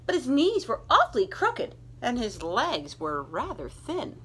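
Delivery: put-on lively read-aloud to children; animated children's story reading; storybook narration. A narrator's reading. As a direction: tell it like a bedtime picture book. Reads picture book aloud.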